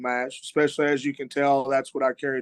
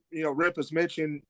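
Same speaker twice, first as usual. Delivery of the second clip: ordinary talk, nothing marked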